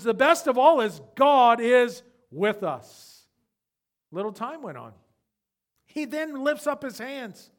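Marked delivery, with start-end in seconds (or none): 0.0-3.3 loud, slow triumphant cry; cried out. Retold or imitated. Old man at death finding his voice, loud cry. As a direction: call it out with sudden strength. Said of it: "he got a voice and says".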